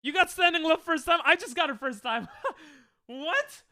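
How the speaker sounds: high, loud, amazed and half laughing; excited disbelief. Real, not acted. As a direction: cry out in happy disbelief. Disbelief at first-try success.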